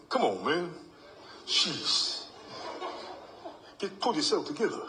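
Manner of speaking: high laughing mock coach voice; imitating Mark. Eulogy speaker imitating late coach scolding him, laughing high mock voice. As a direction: tease yourself like your late coach would. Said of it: "And he'd be going".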